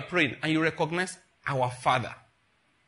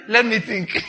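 mock God pondering; 6 semitones higher, 7 dB louder, slower than usual. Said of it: "He said".

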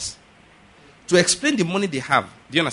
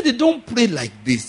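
slow instruction; 7 semitones higher than usual. Retold or imitated. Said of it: "I said".

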